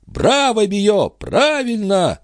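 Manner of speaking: high, drawn-out shouts of approval; cheering crowd. A narrator's reading. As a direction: cheer him on with pitch way up. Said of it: "единодушно подхватили присутствовавшие".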